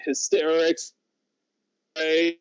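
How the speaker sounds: ordinary delivery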